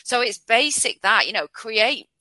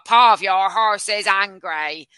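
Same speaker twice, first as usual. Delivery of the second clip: slow, loud mock text-to-speech robot; imitating robot voice; 7 dB louder, slower than usual. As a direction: read it out like a horrible robotic narrator, dragging each word. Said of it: "the robot voice will go".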